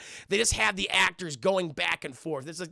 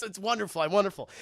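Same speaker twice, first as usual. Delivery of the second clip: ordinary delivery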